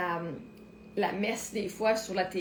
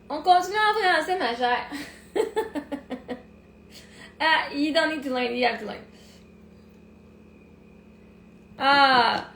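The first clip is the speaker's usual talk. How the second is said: loud, sassy and defiant; 3 semitones higher, 4 dB louder than usual. Real, not acted. Telling the viewer she'll only speak French now, loud and defiant. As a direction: put your foot down, teasing.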